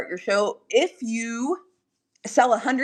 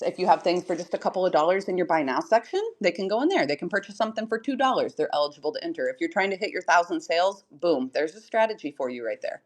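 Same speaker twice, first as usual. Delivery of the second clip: usual speaking voice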